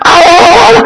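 very high yelps of a dog faking pain; hammed-up yelping dog; fake dog yelps. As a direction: yelp high like a dog putting it on. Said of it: "the dog starts acting".

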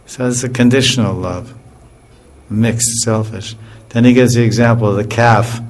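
usual speaking voice

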